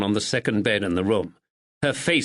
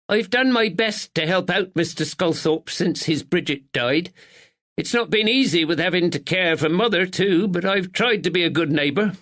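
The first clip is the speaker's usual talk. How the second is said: higher, prim village woman; a proper village neighbour, a touch anxious; spinster neighbour; 8 semitones higher than usual. A narrator's reading. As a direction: speak as a dutiful northern spinster. Miss Bennet voiced in the story.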